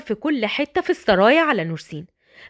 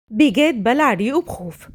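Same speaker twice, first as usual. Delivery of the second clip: plain reading voice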